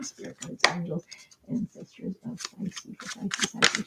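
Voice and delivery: soft unvoiced whisper calling on the spirits; breathy murmured call to the guides; whispered invocation. Real, not acted. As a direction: hush it like a private prayer.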